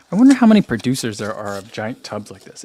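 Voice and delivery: ordinary talk, nothing marked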